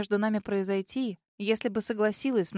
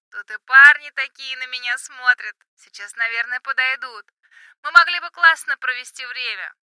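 high, loud yell into the phone; shouting over music; 4 semitones higher, 10 dB louder than usual. Acted. Drunk friend shouting in club.

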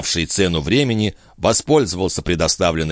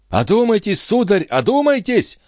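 very high frantic appeal; wailing cry. A narrator's reading. Stationmaster follows Jean begging, wailing cry. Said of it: "вопил смотритель".

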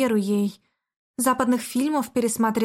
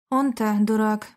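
ordinary delivery

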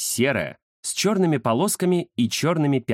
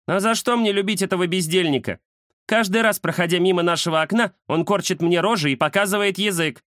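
higher-pitched complaining she-cat; indignant cat Индира; 8 semitones higher than usual. Acted. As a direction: complain about him as an affronted cat. (возмущённая кошка, высокий голос)

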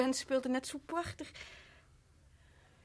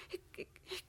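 broken whispered stammer; whispered, breaking; stifled cry; 19 dB quieter than usual. Acted. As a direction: choke on the words. Wife breaks down in fear.

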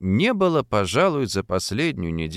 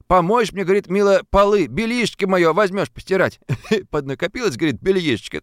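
higher, fast, amused retelling; lively storyteller; 8 semitones higher, 3 dB louder than usual. A narrator's reading. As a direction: spin the tale for a laugh. Fadeykin tells a funny dream.

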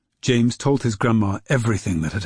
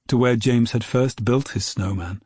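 plain reading voice

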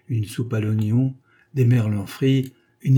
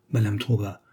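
usual speaking voice

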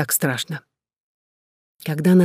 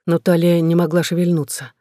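ordinary delivery, nothing marked

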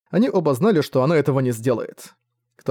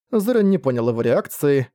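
ordinary delivery

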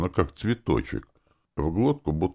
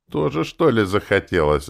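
ironic; 6 semitones higher, 4 dB louder than usual. Acted. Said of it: "ироничный ответ".

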